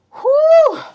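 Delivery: sharp, high "whew" blown out loud; loud high whoop. Real, not acted. Reader overwhelmed by what's going on, loud high whoop.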